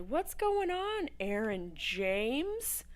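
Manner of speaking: a light put-on voice, slow and drawn out; slow mock greeting. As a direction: stretch the name out playfully.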